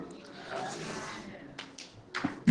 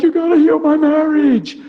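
higher, insistent mock prayer; mock demanding plea; 10 semitones higher, 3 dB louder than usual. Mock praying to God about his marriage, demanding. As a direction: imitate a pushy plea to God. Acting out a desperate prayer he rejects.

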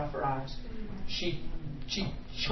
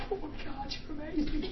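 high, soft, awed voice; hushed high-pitched wonder; retold amazement; 8 semitones higher, 11 dB quieter than usual. Acts his amazed inner reaction.

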